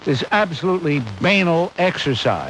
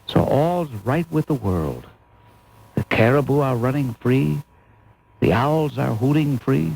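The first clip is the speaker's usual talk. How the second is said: mock serene; 3 semitones lower than usual. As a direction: paint the idyll with a sneer. Sarcastic idyll.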